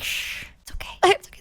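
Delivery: unvoiced hushing whisper; shushing whisper. Artist shushing her over the gift, whispered. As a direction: hush her gently like keeping a secret. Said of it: "like that".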